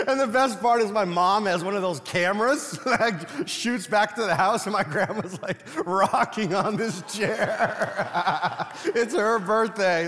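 words broken up by real laughter; laughing while telling. Real, not acted. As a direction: tell it while you can't stop laughing. Telling the funny grandma story.

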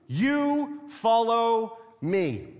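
very slow, each word set down deliberately; slow, weighty quote. Acted. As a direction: let Jesus' command land slowly. Jesus's words, read with weight.

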